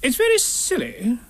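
high, put-on fussy voice; Rabbit. A narrator's reading. Rabbit losing his way, high fussy voice. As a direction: say it high, covering doubt. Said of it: "said Rabbit".

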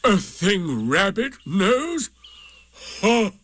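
slow, high, contemptuous donkey; scornful. A narrator's reading. Eeyore dismissing learning as Rabbit's thing, scornful. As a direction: stretch it out, then scoff.

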